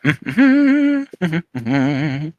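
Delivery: high little hummed tune; carefree humming in a high voice. Acted. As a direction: hum idly to yourself. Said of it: "humming to himself".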